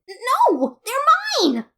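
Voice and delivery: high, loud shriek of "mine"; shriek, clutching the snacks; screech. Acted. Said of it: "she screeched a bit too loudly".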